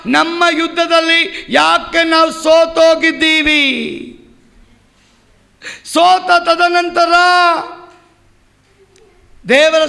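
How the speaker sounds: sustained pulpit cry; impassioned preaching. Acted. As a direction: preach it as a pleading lament to God.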